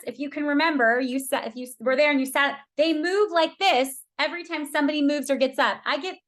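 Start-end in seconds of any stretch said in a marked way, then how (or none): none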